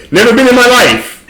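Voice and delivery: high, laughing put-on Jamaican accent; mock Jamaican accent. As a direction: ham up Jamaican patois while cracking up. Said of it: "I actually do a better British accent".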